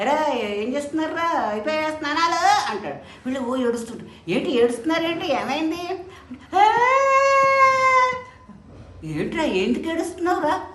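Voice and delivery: a master baffled by the weeping pupils; puzzled guru. Acted. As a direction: guru questions his crying disciples. Guru asks why they cry.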